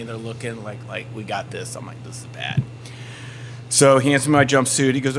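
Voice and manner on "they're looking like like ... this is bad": very soft, sinking aside; muted, uneasy undertone; hushed dread. Acted. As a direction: drop almost to a whisper as the dread sinks in. Intimidated before a silent crowd of inmates.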